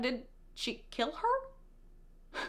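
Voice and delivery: hushed, drawn-out question; mock tentative. Cassian asking whether she killed the Weaver, mock tentative. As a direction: ask it slowly and very softly, as if imitating awe.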